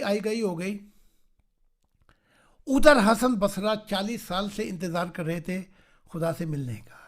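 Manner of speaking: normal talking voice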